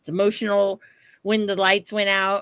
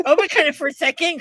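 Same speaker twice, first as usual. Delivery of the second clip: laughing; 9 semitones higher, 6 dB louder, faster than usual. Real, not acted. Laughing while retelling.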